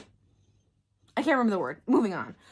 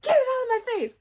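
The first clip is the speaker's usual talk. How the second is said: high, loud grossed-out cry; disgusted outburst; 13 semitones higher, 7 dB louder than usual. Real, not acted. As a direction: recoil in disgust. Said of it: "disgust reaction".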